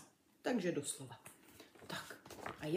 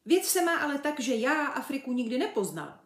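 high, animated chimp voice; lively animal confiding its story; earnest chimp; 6 semitones higher, 6 dB louder, faster than usual. A narrator's reading. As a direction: launch eagerly into the explanation. Chimp character explains.

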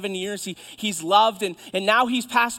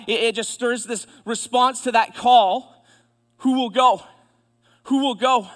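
raised, ringing call; full-force preaching; 3 semitones higher, 7 dB louder than usual. Real, not acted. Preacher voices God's call to Isaiah, full-force preaching. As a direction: throw the question out loud and high.

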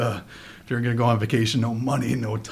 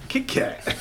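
giggling plain repeat; laughing quick echo. Retold or imitated. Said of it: "I like how you say it".

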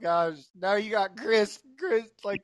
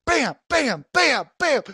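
higher, punchy "bam" repeated; imitated punches; 5 semitones higher, 4 dB louder, slower than usual. Son tells how the shot floored him, imitated punch sounds. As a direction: hit each bam like a blow.

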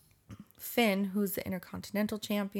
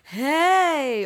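loud, high drawn-out shout; big mock attention-getting call; called-out hey; 7 semitones higher, 9 dB louder than usual. Shown as a demonstration. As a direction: holler it as a sample yell. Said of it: "It wasn't even like a".

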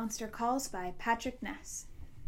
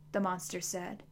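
plain reading voice

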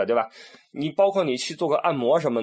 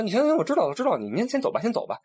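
higher, careless wave-away; dismissive brush-off; 9 semitones higher, faster than usual. Retold or imitated. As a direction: brush the guide off lazily. Tourists brushing the guide off. (满不在乎地打发导游)

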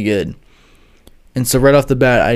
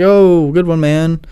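raised, awkward fake-cheerful reply; forced good sport; 4 semitones higher, 3 dB louder, slower than usual. Pranked tutor answering the YouTuber, forced cheer. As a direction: laugh it off though you're not sure.

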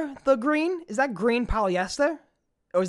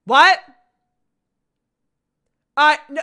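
loud, higher sudden shout; startled; 5 semitones higher, 9 dB louder than usual. Real, not acted. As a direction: blurt it out alarmed. Sudden alarm on stream.

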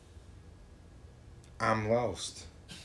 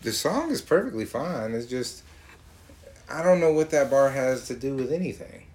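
ordinary talk, nothing marked

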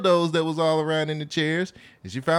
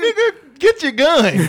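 mock urgent; 7 semitones higher, 6 dB louder, slower than usual. Joking bear reaction.

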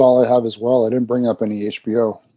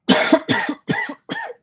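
exaggerated hammy cough; fake cough; 4 dB quieter than usual. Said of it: "what is with this fake cough".

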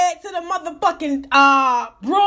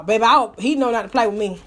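ordinary delivery

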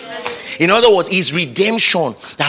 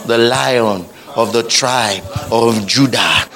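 solemn proclamation; 4 semitones lower, slower than usual. Acted. Slow proud proclamation.

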